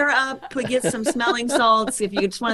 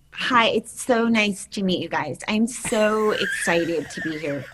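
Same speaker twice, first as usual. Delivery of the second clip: Drew Barrymore lisp. Shown as a demonstration. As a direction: gush sweetly with a light lisp. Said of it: "show me".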